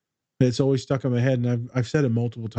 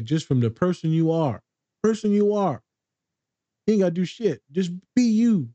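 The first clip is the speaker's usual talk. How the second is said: emphatic encouragement; 6 semitones higher than usual. Retold or imitated. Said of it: "And I said".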